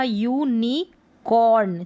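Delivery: यूनिकॉर्न slowly split as "yoo... nee... corn"; sounding out. Shown as a demonstration. Teacher reading unicorn for learners, slow sounding out. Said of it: "यू को यू पढ़ा".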